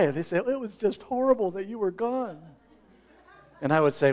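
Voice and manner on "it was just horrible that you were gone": high, syrupy sympathy; mock fawning member. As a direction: play the over-sweet churchgoer. Congregant's fawning remark imitated.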